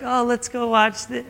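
slow, high-pitched eager wife; imitating wife. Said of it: "and your wife's like".

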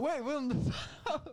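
high, slow, laughing cartoon voice. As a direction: say it while cracking up. Repeated through laughter.

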